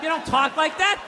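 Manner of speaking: ordinary talk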